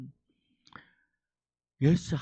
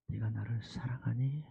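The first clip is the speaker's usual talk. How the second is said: soft unvoiced whisper; 8 semitones lower, 11 dB quieter than usual. Jesus asking Peter if he loves him, whisper. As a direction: ask tenderly under the breath.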